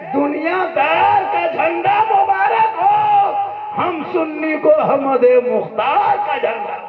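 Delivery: high, ringing preacher's shout; rousing sermon climax, pitched high; rallying cry. Real, not acted.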